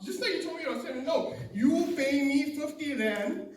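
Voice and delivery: high, loud, drawn-out demand; acted irate Pakistani vendor; angry seller. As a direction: insist on the money, hammed up. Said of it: "he got angry at me".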